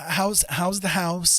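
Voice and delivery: ordinary talk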